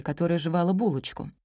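ordinary delivery

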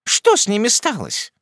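put-on high, sharp interrogating old aunt; questioning. A narrator's reading. Miss Betsey questioning the young widow sharply. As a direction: grill her like a stern aunt. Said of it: "допрашивала мисс Бетси".

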